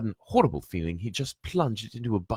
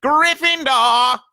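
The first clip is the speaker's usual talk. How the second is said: higher, louder, drawn-out slow call; hat shouts; 10 dB louder, much slower than usual. Acted. The Sorting Hat announcing Ron's house, loud call. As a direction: ring it out, slowly. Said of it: "the hat had shouted".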